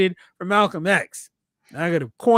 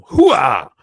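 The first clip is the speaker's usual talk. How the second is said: loud gung-ho soldier; 3 semitones lower, 9 dB louder than usual. Pretend. Army cheer shout.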